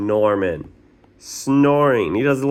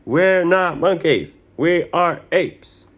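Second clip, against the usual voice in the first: grumpy protest; 9 semitones higher, 4 dB louder than usual. Acted. Grumpy son protests to mom.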